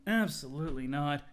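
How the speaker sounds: ordinary talk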